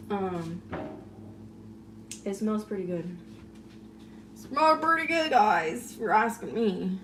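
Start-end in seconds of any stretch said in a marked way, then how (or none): 4.4-5.3 higher, playful put-on voice; silly voice. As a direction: say it goofily, pitched up. Repeats it in a silly voice.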